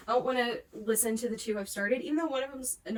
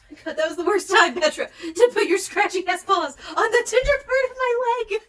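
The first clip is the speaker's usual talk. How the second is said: loud, high pained yelp at the cat; pained yell; 9 semitones higher, 13 dB louder than usual. Real, not acted. Yelping at the cat clawing her leg. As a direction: yell as claws dig into your leg.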